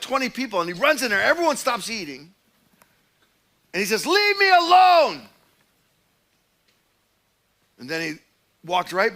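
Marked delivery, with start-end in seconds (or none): 4.0-5.3 high, loud, slow scream; slow, loud, desperate plea to be left alone; anguished shout. Retold or imitated. Troubled man screams in diner.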